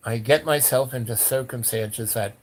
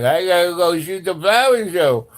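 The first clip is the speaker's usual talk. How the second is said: loud, higher, distorted put-on voice; tripping stoner; 5 semitones higher, 6 dB louder than usual. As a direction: imitate someone high insisting they have a job. Mimics own tripping self repeating it.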